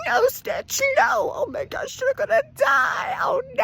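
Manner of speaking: very high, squeaky fake wail; hammed-up weepy panic; mock crying. Imitating characters crying over dying Stitch, mock wailing. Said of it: "they're all crying, saying".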